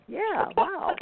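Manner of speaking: high, amazed "wow"; awed wow. Retold or imitated. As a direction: let the voice leap up in wonder.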